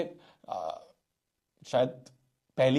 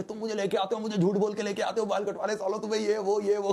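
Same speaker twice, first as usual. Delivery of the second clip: higher-pitched imitation of a child's tantrum; mock angry child yelling; 5 semitones higher than usual. Boy yelling he was tricked to the barber, mock tantrum. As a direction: pretend shouting at the lying grown-ups. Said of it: "चिल्ला रहा हो कि".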